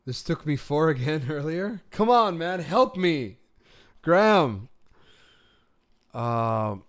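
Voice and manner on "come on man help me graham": high, loud exasperated plea; frustrated cry for help. Real, not acted. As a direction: beg the puzzle to give in. Frustrated at Wordle puzzle.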